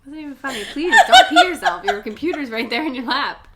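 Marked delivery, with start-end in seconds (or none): 0.1-1.8 high, helpless laughing plea; giggly, rising to a loud squeal. Real, not acted. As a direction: beg through laughter. Teasing while both crack up.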